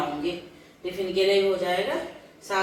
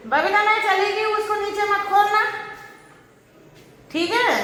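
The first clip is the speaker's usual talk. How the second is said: calling out; 11 semitones higher than usual. Real, not acted. Calling out to Babita while leaving.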